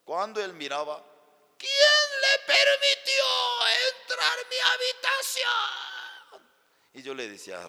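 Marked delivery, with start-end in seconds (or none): 1.6-6.5 very high, drawn-out husband's bellow; angry shouting. Retold or imitated. Husband to the pastor who entered his bedroom, outraged shout. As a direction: roar it out slowly in mock fury.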